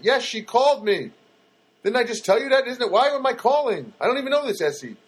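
high-pitched comic indignation; hammed-up exasperated rant; exasperated retort. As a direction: protest in mock exasperation, pitch climbing high. Acting out his exasperated reply.

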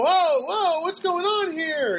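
high, drawn-out mock alarm; startled reaction. Man to a little dog yapping at him, startled.

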